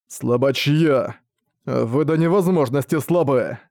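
очень медленно, с презрением; растягивай слова, как чудовище; презрительный монстр. Acted. Монстр презирает учеников. (презрительный голос монстра)

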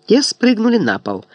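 usual speaking voice

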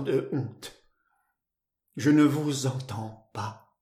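halting, anguished confession with long pauses; bitter and despairing. A narrator's reading. Woman confessing to her lover that she is deaf, bitter despair. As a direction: despair drawn out, faltering before the admission. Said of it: "voix amère et désespérée".

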